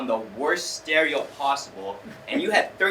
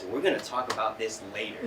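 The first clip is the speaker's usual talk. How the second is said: softer, lower threat; low stern warning; 6 semitones lower, 8 dB quieter than usual. Retold or imitated. Best friend threatening him after the ceremony, low and quiet. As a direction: mutter it like a warning. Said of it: "my friend says".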